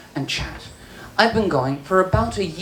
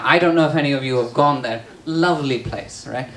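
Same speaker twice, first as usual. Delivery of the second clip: usual speaking voice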